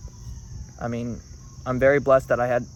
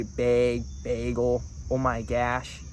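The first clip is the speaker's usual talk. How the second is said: Minnesotan accent; 2 semitones lower, 3 dB quieter, much slower than usual. Shown as a demonstration. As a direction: slip into a Minnesotan accent, with "gosh" like "gash". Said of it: "me being in Minnesota, if I do say".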